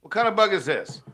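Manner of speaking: high, loud mock question; mocking squeaky voice. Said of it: "I came up with a visual aid".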